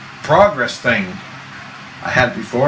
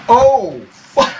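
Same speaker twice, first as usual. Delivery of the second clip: alarmed; 9 semitones higher, 3 dB louder than usual. Retold or imitated. Alarm at fire.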